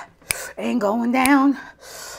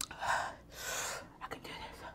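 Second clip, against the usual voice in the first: whisper; 22 dB quieter, much slower than usual. Real, not acted. Psyching up through the pain.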